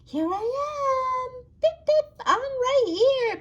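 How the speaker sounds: very high, slow toy character voice; Pip. Pretend. Delivery partner toy reports in, high squeaky voice. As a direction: chirp it high and loud, stretching the words. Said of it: "Pip and Freddy are ready".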